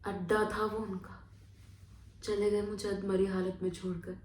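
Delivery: low, subdued telling; spent, desolate. Acted. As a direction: let the voice sink after the outburst. Left half-dead, spent. (बुझी हुई, धीमी आवाज़ में)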